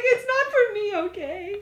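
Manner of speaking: high, loud, teary whine through laughter; crying girlfriend, hammed-up; mock crying. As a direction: whimper it like you're crying. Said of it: "you just started crying".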